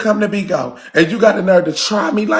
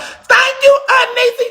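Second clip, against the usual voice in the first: imitating Trina. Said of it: "Trina called me talking about".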